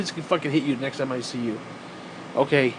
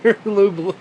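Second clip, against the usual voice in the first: cracking up at your own joke; laughing; 7 semitones higher than usual. Real, not acted. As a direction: giggle the silly name out. Cracking up at invented silly name.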